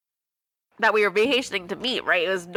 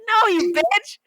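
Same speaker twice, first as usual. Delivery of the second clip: high-pitched indignant retort; mock exasperation; 12 semitones higher, 4 dB louder, slower than usual. Correcting a friend's silly guess about the dead bird, mock exasperation. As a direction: scold a friend in a high voice, half joking.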